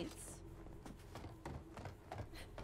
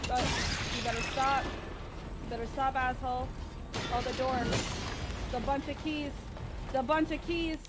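high, loud desperate shouting; frantic panicked yelling; 6 semitones higher, 6 dB louder than usual. Real, not acted. Monster chasing, frantic yelling.

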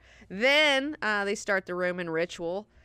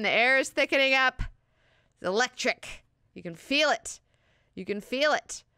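building suspense; 7 semitones higher, 4 dB louder than usual. Acted. Hyping the moment before the exorcism.

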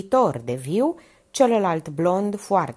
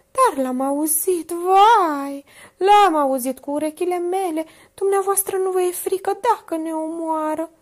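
high, anxious voice of a scared woman; higher nervous maid, louder on vai; frightened Minerva; 12 semitones higher, 5 dB louder than usual. A narrator's reading. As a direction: plead with mounting fear.

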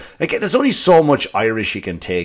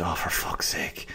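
very soft, low swearing under the breath; exasperated whisper; 6 semitones lower, 15 dB quieter than usual. Retold or imitated. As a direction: whisper it to yourself, slowly. Muttered reaction at father's funeral.

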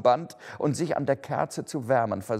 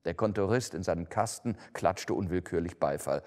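plain reading voice

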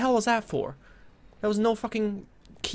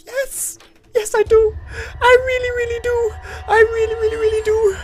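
much higher, loud sobbing shriek; tearful panicked shriek; 7 dB louder than usual. Real, not acted. Gamer answering the villain's taunt, high tearful shriek. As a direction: plead hysterically at the villain.

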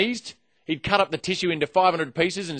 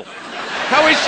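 leering aside; 9 semitones higher, much slower than usual. Pretend. Leering aside about Lara Bingle.

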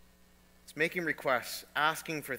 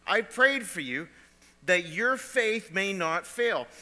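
forceful quote; 4 semitones higher, 6 dB louder, slower than usual. Acted. Jesus quoted at full force.

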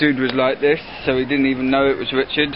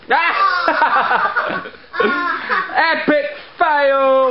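high laughing cry of epic fail; squealing with laughter; 12 semitones higher, much slower than usual. Real, not acted. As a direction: crack up and yell it out. Laughing at a failed trick.

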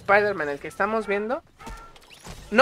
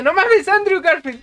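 higher, louder thrilled exclamation; amazed cry; 8 semitones higher, 5 dB louder than usual. Retold or imitated. Seeing Andrew Garfield on screen, an amazed cry. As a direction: call out the actor's name in disbelief. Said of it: "Pues yo dije".

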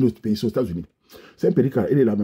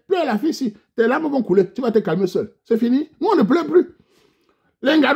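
much higher, cocky mock voice; high, louder swaggering taunt; defiant taunt; 11 semitones higher, 4 dB louder than usual. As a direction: brush off the insults. Taunting women who insult him.

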